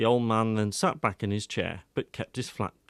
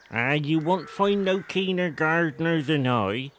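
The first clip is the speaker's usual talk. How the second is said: put-on slow, higher, rustic old man's voice; a proud old villager boasting of his garden; old Wiltshire countryman; 6 semitones higher than usual. A narrator's reading. Old gardener Collingbourne Tuck boasts to the reporter, slow rustic old voice.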